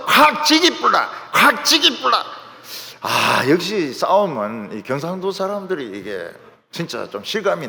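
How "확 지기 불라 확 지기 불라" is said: loud, high Gyeongsang dialect outburst; imitated Gyeongsang fight talk; Gyeongsang-accent threat. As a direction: mimic a Gyeongsang brawler's threat, punchy and raised.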